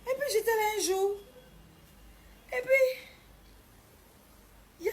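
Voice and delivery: very high, loud announcement; dramatic exclamation. Retold or imitated. Storyteller announcing the day things turned, dramatic. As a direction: shoot right up to launch the twist. Suspenseful turn in her story.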